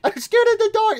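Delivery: very high, giggling through the scared line; laughing mock fright. Gamer laughing while voicing scared Mimic, high giggly squeal. Repeats laughing, much higher.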